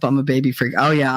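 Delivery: ordinary delivery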